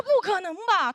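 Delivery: high, loud incredulous question; acted disbelief. Narrator hearing a colleague's rumour, exaggerated disbelief. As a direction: exclaim it as if stunned.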